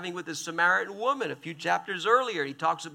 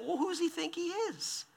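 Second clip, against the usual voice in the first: grumbling scorn; 11 semitones higher, 8 dB quieter than usual. Retold or imitated. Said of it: "They're saying".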